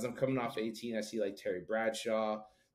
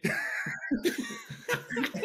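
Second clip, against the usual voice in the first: burst of laughter. Real, not acted. Laughing at the athlete's joke.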